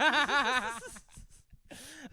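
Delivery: loud high-pitched giggle; high laugh. Real, not acted. Laughter at the joke.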